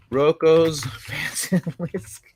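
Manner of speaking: slow, laughing over the name. Real, not acted. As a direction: stretch it out slowly, laughing. Echoing the name ominously.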